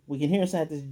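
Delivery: ordinary delivery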